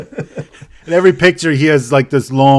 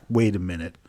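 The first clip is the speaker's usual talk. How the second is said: lower, softer suspicious mutter; suspicious realization; 4 semitones lower, 8 dB quieter than usual. Retold or imitated. Guy suspects vaccine.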